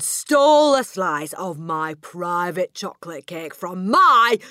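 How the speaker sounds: slow, very loud, outraged accusation; shouting tirade. A narrator's reading. Headmistress accusing the boy of stealing her cake, shouting. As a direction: thunder it out word by word, high and indignant. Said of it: "the Trunchbull shouted".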